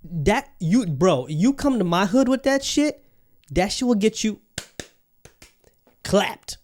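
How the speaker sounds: ordinary talk, nothing marked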